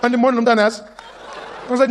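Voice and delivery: ordinary delivery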